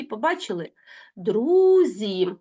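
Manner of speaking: ordinary delivery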